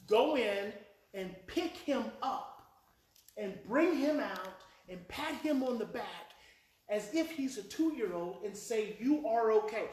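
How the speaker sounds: slow, deliberate directions with weight on each step; slow, emphatic instruction. Retold or imitated. Therapist repeats the instruction to a dad who didn't hear, slow and emphatic. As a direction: spell it out slowly, step by step.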